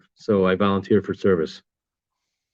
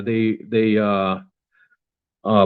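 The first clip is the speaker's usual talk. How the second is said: ordinary talk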